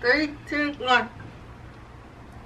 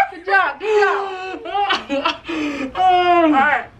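laughing; 3 semitones higher, much slower than usual. Real, not acted. Cracking up while cheering.

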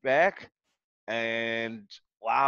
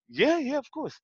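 eager higher yes; 11 semitones higher than usual. Retold or imitated.

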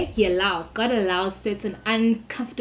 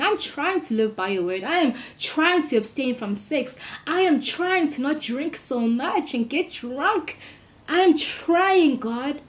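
pleading prayer; 6 semitones higher than usual. Retold or imitated. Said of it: "I don't say, like".

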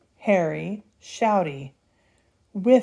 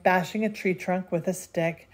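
ordinary delivery

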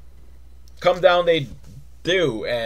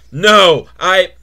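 defiant shout; 4 semitones higher, 10 dB louder than usual. Acted. Defiant outburst.

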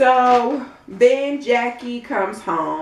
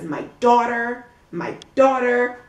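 slow mocking echo of "My daughter", a bit higher; mocking imitation; 4 semitones higher than usual. Vlogger mocking Jackie's favourite phrase, slow mock voice. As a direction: mimic the way she keeps saying "my daughter". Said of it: "She irks me when she says".

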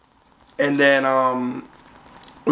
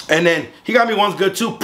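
usual speaking voice

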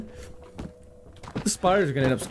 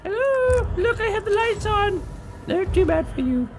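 very high silly falsetto; high-pitched singsong goading; mocking taunt. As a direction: squeal a taunt at the monster. Taunts monster in put-on voice.

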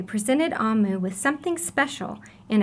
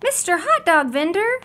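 high, louder surprised greeting; exclaimed welcome to the vendor; exclaiming; 8 semitones higher, 5 dB louder than usual. Acted. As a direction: call his name out in surprise. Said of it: "Amu exclaimed".

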